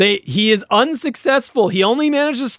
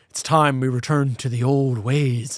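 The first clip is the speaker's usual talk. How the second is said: mock villain monologue; 5 semitones lower than usual. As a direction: intone it like an ominous cult leader. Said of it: "Cochran's basically just like".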